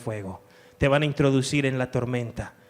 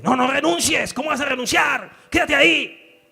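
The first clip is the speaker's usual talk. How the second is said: higher, loud insistent shout; devil's shouting voice; 10 semitones higher, 6 dB louder than usual. Retold or imitated. Pastor acting the devil forbidding resignation, shouting. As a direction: bark the devil's orders at them. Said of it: "El diablo te dice".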